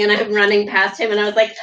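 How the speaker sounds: ordinary delivery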